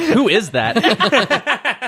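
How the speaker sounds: high, slow, stunned question through a laugh; shocked who-is-that. Retold or imitated. Roommate retelling the shock at the costumed stranger, slow stunned high question. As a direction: stretch it out in disbelief, half laughing.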